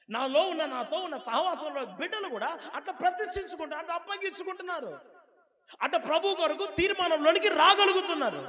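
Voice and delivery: high, loud preaching with bursts of laughter; fiery preaching. Acted. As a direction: preach it at full force to the congregation. Pastor preaching at full force.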